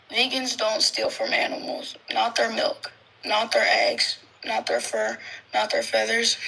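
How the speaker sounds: measured card reading with a pause at each comma; even recital, pausing between phrases; slow, emphatic reading. Shown as a demonstration. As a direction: read it out deliberately, item by item. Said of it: "you're gonna read this slowly".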